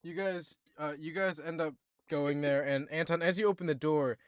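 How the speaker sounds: ordinary talk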